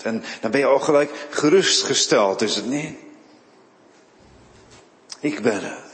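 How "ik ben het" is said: soft reassurance. Acted. Jezus' reassuring words again.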